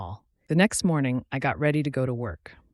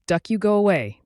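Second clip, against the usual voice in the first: raised, higher angry shout; 6 semitones higher, 4 dB louder than usual. A narrator's reading. Wife shouts at her husband through the door, raised angry shout. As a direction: shout him away from the door. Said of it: "Julie shouted at me".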